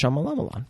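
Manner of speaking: Shyamalan with extra syllables, "Shyamala-malan"; mangled name. Real, not acted. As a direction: stretch Shyamalan into Sha-ma-la-ma-lon. Repeats the mangled name after correction.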